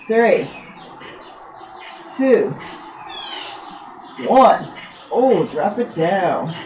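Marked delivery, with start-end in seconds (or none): none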